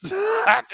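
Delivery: loud high laugh; shrieking laugh. Retold or imitated. Laughing shout after quote.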